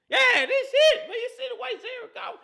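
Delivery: oversell it like a giddy believer; mock hype. Host mimicking fans' hype about the Lions, loud and high.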